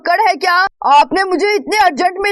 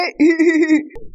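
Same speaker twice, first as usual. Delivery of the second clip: laughing. Acted. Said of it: "आप क्यों हंस रहे हैं?".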